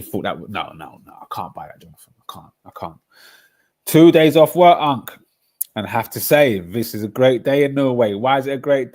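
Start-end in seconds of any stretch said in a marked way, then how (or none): 3.9-5.1 shouted, higher hype call-out; loud, excited greeting to Unc. Real, not acted. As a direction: bellow it across the room. Greeting a friend loudly.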